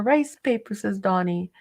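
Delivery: ordinary talk, nothing marked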